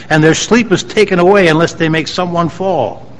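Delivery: preacher declaiming a father's commands; exhorting scripture reading. Real, not acted. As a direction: press each command on the listeners. Reads proverb as urgent exhortation.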